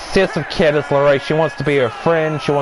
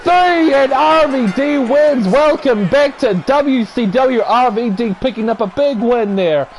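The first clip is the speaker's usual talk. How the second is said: excited play-by-play; 9 semitones higher, slower than usual. Pretend. Commentator calling the finishing move and pinfall, excited shouted play-by-play. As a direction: shout the finish, stretching the big words.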